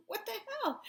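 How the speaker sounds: high, breaking what-the-hell; incredulous high-pitched exclamation; exasperated disbelief. Real, not acted. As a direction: squeak it out in disbelief. Exasperated outburst after denial.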